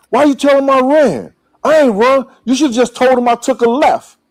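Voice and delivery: loud, high, indignant complaint; indignant protest. Retold or imitated. Puff scolding Gene for saying he ran. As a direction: protest loudly that he never ran.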